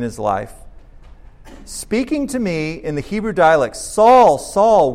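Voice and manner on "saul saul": a voice from heaven calling him by name; loud call. Acted. Pastor reading Jesus calling Saul on the road, loud call.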